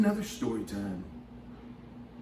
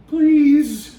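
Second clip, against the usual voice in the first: much higher, loud falling "please."; loud pleading please; 14 semitones higher, 13 dB louder than usual. Acted. Mouse begs the laughing elephant to stop, a high loud "please.". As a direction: beg with a big loud "please.". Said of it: "laugh softly".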